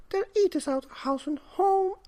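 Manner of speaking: put-on very high, loud old woman's voice; worried old woman. A narrator's reading. Old wife worries to husband, very high fretting. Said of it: "said the very old woman".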